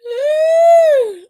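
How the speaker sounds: high, loud screaming laugh; shrieking laugh. Real, not acted. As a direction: howl with laughter at the clip. Cracking up at the clip.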